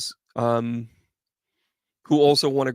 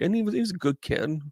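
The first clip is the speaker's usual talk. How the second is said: ordinary talk, nothing marked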